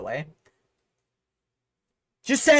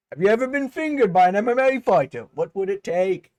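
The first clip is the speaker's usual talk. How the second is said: Aspinall impression as done by [name]. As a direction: taunt like a cocky British brawler in a British accent. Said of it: "[name] does his, uh, Aspinall impression".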